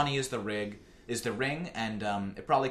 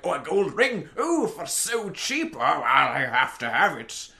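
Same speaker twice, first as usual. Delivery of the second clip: mock-excited gasps over a cheap ring; gullible buyer; 3 semitones higher, 3 dB louder, slower than usual. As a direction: play the dazzled sucker, slow and louder. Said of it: "people are like".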